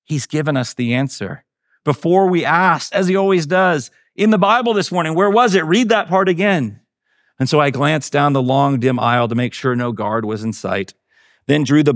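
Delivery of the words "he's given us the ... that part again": high, eager rush of discovery; excited. A narrator's reading. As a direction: bubble over with eager excitement, voice pitched high. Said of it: "she said excitedly".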